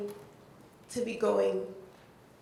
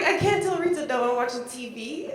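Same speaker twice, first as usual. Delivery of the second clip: fast, louder, laughing excitement; excited quote; 4 semitones higher, 8 dB louder than usual. Retold or imitated. Retelling her answer to Rita, fast giddy excitement. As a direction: rush it out, giddy and loud. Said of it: "But I was like".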